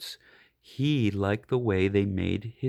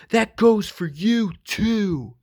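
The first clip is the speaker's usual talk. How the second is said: higher, louder gruff bark; barked order; 8 semitones higher, 5 dB louder, slower than usual. Acted. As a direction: bark it at the bunny like a tough dog. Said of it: "Mike barked".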